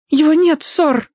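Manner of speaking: very high, nervous squeak; frightened maid. A narrator's reading. Maid tells the colonel the butler is gone, scared squeak. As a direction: blurt it while shrinking away. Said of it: "Джейн попятилась".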